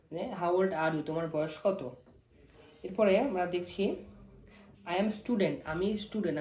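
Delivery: usual speaking voice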